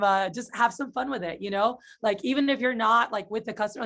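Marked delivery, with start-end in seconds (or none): none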